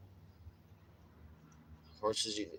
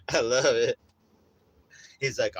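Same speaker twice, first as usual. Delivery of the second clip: ordinary talk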